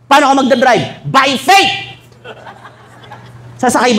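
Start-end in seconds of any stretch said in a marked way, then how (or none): 1.0-1.9 high proud rising answer; confident churchgoer answering bishop; naive congregant. Acted. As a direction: say it like it's obvious.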